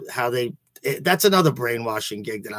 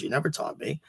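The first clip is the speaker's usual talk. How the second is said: softer echo with never rising; a quieter replay of the complaint; quiet repeat; 3 semitones higher, 8 dB quieter than usual. Real, not acted. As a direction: drop to a hushed, rising You never taught me. Repeating the accusation, dropping low.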